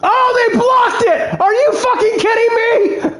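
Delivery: high, yelling, laughing in disbelief; outraged disbelief. Real, not acted. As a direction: scream at the blocked kick, half laughing. Field goal gets blocked.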